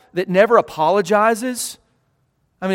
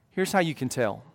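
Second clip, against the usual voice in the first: usual speaking voice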